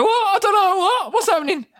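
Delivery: high, loud, startled squawk; hammed-up alarmed yelping; bewildered panic. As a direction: play a flustered bystander caught off guard. Acts startled bloke being yelled at.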